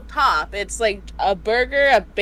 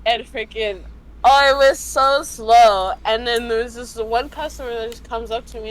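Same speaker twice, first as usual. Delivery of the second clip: normal talking voice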